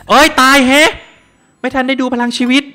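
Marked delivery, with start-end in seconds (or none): 0.1-0.9 high, loud startled cry of dismay; startled yelp. Real, not acted. Streamer yelps loud when his character suddenly dies.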